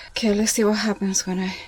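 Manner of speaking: normal talking voice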